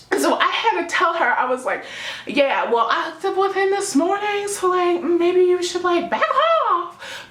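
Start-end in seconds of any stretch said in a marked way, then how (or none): 2.3-5.8 sassy. Retold or imitated. Telling off a rival girl. 5.8-7.3 sassy. Retold or imitated. Telling off a rival girl.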